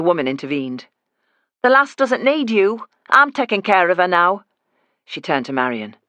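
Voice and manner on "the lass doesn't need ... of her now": put-on mother shields the pregnant girl, loud and firm; protective rebuff. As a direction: stand between her and the girl. Woman defends Marion.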